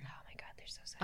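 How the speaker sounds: breathy swooning whisper. Real, not acted. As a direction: whisper it dreamily. Swooning over Scottish accents.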